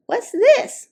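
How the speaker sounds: put-on high, bright little girl question; curious little girl. Little girl spotting the display, high and curious. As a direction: ask it as an eager small child. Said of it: "she asked".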